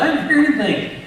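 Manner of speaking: high, loud puzzled buddy voice; squeaky-high bewildered friend; baffled buddy. Retold or imitated. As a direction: play the confused fishing buddy. Buddy denies hearing anything.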